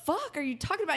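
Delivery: high incredulous question; can't believe what he said; high disbelieving retort. Retold or imitated. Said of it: "looked at him like".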